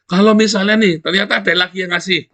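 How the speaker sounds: high, loud push to give things away; loud preaching. Acted. Preacher urging aid recipients to give away, loud preaching. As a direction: urge them hard to share.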